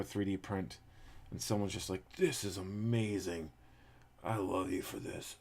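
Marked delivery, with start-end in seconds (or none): 2.2-5.4 lightly put-on voice for someone amazed; slightly silly mock-amazed voice; odd gift-receiver voice. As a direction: imitate an odd-sounding gift recipient. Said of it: "I don't know why they're talking like that".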